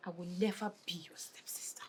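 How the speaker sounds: cold whisper; whispered threat. Acted. Girl to her future stepbrother, cold whispered refusal. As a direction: hiss the refusal under your breath.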